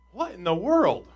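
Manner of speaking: acted bewildered outburst; startled disbelief. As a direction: react as if the roof just opened. Acts startled reaction to intruders.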